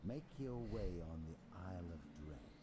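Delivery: low, cold, measured god voice; lightly voiced dark god. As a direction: speak down as an all-powerful god. Vecna addressing trapped players.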